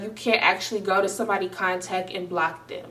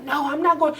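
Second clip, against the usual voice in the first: higher mock whiny refusal; mocking boyfriend; 6 semitones higher than usual. Imitating her boyfriend refusing, snotty. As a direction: sneer it back as him.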